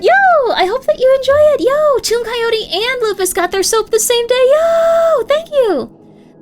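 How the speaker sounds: high, loud burst of joy; real streamer squeal of congratulations; excited thanks. Real, not acted. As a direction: yelp with delight at the winner. Thanking giveaway winners on stream.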